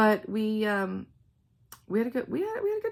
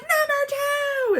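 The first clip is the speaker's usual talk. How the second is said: much higher, slow, drawn-out cheer; group cheer; 6 dB louder, much slower than usual. Retold or imitated. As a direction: call it out like fans chanting. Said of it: "they would all start screaming".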